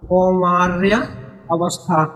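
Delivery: कौमार्य, a clear pause, then अवस्था; measured dictation of कौमार्य अवस्था, split by a pause; word-by-word repetition. Shown as a demonstration. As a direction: spell out कौमार्य अवस्था slowly, one word at a time. Teacher repeats the term word by word.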